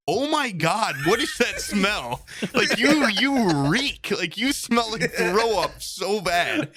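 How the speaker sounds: a hint of appalled friends in a car; grossed-out girls. Girls in the Uber recoiling at his smell, mild mock disgust.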